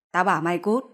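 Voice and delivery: loud, rising furious yell; mother shouting at her son to get out. Acted. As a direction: bark him out the door. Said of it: "Bà Miên hét lên".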